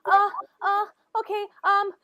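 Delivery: put-on young boy urging strangers to follow; urgent boy. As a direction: hurry them along as the scared boy. Boy urges players along.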